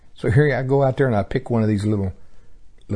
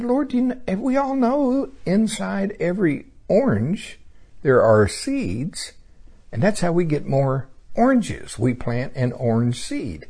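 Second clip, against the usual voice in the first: high prayer voice; 8 semitones higher than usual. Retold or imitated. Preacher voicing his prayer to God.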